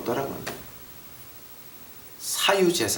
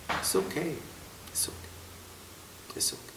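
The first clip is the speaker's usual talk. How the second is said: whispered, much softer "It's okay"; 5 semitones higher, 18 dB quieter, much slower than usual. Real, not acted. Preacher reassuring congregation, whispered and fading. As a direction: fade away gently.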